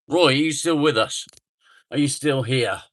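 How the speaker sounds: loud call to Roy; loud check-in with a viewer. Real, not acted. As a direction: call out to check he's still there. Calling to viewer who went quiet.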